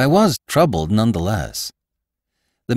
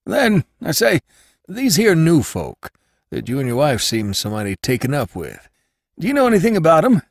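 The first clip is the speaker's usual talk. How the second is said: higher, louder gossipy landlord voice; Jacobus, landlord; 10 semitones higher, 3 dB louder than usual. Acted. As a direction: play the nosy old landlord, pitched up.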